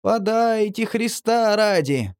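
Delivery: high, slow pitiful plea; plaintive begging. A narrator's reading. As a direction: beg pitifully in the wind. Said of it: "Раздаётся жалобное".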